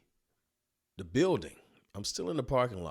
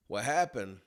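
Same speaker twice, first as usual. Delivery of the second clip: normal talking voice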